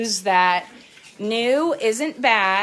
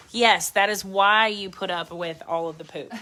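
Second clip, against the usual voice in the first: ordinary talk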